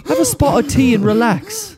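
put-on calm him like an old British actor; posh English gentleman. Playing posh Hopkins.

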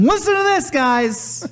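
high announcer over loudspeaker. Retold or imitated. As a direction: call out to the room.

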